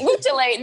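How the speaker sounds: laughing "Hoo chile", with chile said chuh-LAY; echoed mispronunciation. Retold or imitated. Joining the friend's mockery, "chile" wrongly said chuh-LAY. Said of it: "it's them being like".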